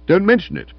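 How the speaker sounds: be formally polite through offence; stiff. A narrator's reading. Stilton acknowledging Florence's thanks, loudly and stiffly. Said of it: "he said stiffly".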